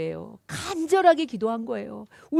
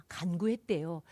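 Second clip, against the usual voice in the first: hushed; 7 semitones lower, 8 dB quieter than usual. Acted. Pastor hushes the quoted verb.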